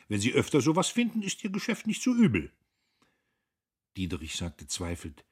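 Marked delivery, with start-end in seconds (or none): 0.1-2.5 Guste. A narrator's reading. Guste voiced in read novel.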